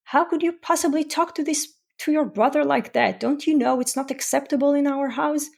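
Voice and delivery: high, mock-indignant scolding; hammed-up outraged parent; scolding sibling. Shown as a demonstration. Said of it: "one way to approach it and say to the sibling".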